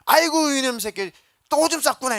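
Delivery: high, loud mock scold of a neighbor woman; neighbor lady's scolding. Neighbor woman teasing the boy in the winnowing basket, high loud mock scolding. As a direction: belt it out like a village auntie catching the bedwetter. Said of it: "그 아줌마가 그 딱 보고 알아요".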